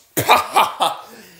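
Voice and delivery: loud, high burst of laughter; scoffing laugh. Real, not acted. Host laughing at talk of viewers' patience, loud scoffing laugh. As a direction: burst out in a big high cackle.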